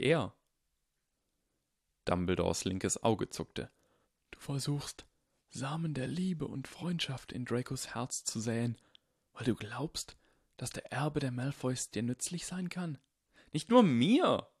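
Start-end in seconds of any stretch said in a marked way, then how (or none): 4.3-13.0 put-on old wizard, hushed and grave; quiet, worried old headmaster; voiced old Dumbledore. Dumbledore warning Harry, hushed old wizard voice. 13.5-14.5 put-on sharp, louder, higher indignant boy. Harry indignantly correcting Dumbledore, higher and louder. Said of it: "sagte Harry empört".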